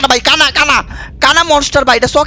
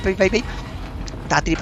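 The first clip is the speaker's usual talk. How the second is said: ordinary talk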